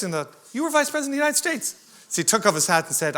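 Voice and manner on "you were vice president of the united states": retold gasp of disbelief, much higher and fast; astonished disbelief. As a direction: replay the shock of realising who he is. Retold stunned reply to Pence.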